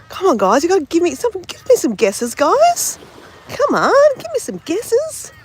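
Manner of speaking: playful begging; pleading for guesses. Real, not acted. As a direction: egg the chat on, rising and eager.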